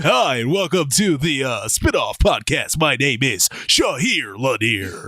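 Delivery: slow, lightly hammed radio-host intro; mock podcast host voice. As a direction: drawl the welcome like a cheesy announcer. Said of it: "please don't do that".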